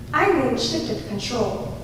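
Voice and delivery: ordinary delivery, nothing marked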